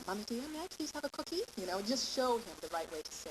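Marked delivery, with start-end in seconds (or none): 0.1-1.4 higher, sweet polite asking; sugary polite child, much higher. Shown as a demonstration. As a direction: model the nice way to ask. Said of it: "you just model it".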